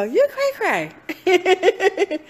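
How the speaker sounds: high, drawn-out playful mock scolding; teasing self-talk. Speaker teasing herself in her own self-talk, high and slow. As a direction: tease yourself slowly, singsong. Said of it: "I go".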